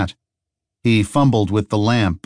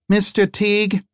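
high call across the room; shouting across a room; 12 semitones higher than usual. A narrator's reading. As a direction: call his name across a noisy room. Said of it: "Harry shouted across the room".

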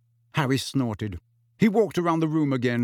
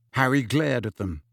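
usual speaking voice

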